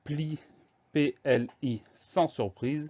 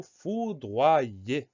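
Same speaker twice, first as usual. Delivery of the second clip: slow dictation; 3 semitones higher than usual. Shown as a demonstration. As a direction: stretch foudroyer syllable by syllable. Teacher presents word to spell.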